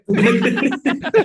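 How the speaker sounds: high, loud laugh; loud burst of laughter. Real, not acted. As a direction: crack up loudly. Big laugh after bagel story.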